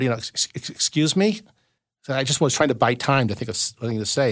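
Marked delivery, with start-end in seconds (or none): 0.2-1.4 nervous stammer. Retold or imitated. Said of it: "I stammered".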